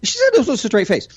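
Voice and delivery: ordinary delivery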